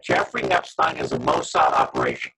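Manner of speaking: glitchy robotic call distortion; garbled digital robot voice. Real, not acted. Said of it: "You started to sound like a robot".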